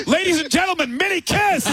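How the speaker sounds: high, big announcer intro; emcee announcement. Retold or imitated. Producer Joe dumping the intro on the crowd, mock announcer. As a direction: announce the band with a flourish. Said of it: "he goes".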